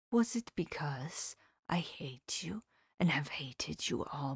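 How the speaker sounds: put-on soft, half-whispered old professor, slow; Van Helsing. Old professor whispering his lesson to his pupil, hushed. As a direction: confide each truth in a near whisper.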